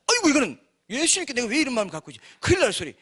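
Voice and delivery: quick, high-pitched startled realisation; mock alarm. Imagined Judas catching his thought.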